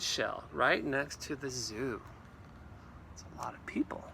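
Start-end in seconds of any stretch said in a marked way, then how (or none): 3.1-4.0 soft hushed aside; whisper. Real, not acted. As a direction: whisper it like a secret.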